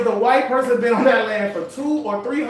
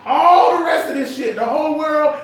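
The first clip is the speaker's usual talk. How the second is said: high, louder emphatic shout; raised voice, repeating the rant; 6 semitones higher, 4 dB louder than usual. Real, not acted. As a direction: hammer the point home.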